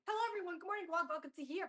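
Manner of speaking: high and loud, bursting hello to viewers; hyped stream opener; bright excited greeting. Real, not acted. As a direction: greet the stream with a big lift. Opening greeting of live stream.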